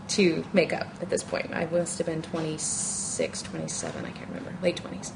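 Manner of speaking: normal talking voice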